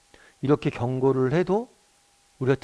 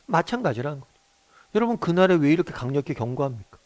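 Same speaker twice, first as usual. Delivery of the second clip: ordinary talk, nothing marked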